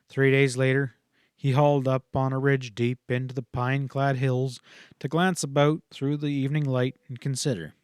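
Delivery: ordinary delivery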